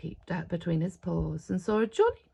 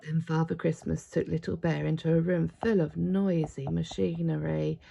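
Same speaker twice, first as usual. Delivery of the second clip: plain reading voice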